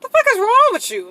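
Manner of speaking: high, loud fed-up yell; exasperated outburst. Retold or imitated. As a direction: let the frustration burst out. Exasperated rant at girlfriend.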